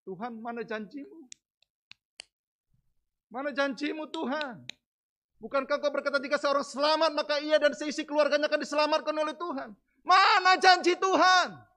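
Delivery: pleading with God, rising to a cry at the end; desperate prayer. Retold or imitated. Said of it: "saya sudah hampir tawar hati".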